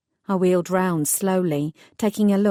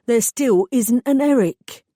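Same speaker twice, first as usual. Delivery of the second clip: slow, gruff East End man, louder; a burly East End mechanic taking over; East End accent; 5 semitones higher, 5 dB louder, slower than usual. Acted. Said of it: "distinctly East End accent".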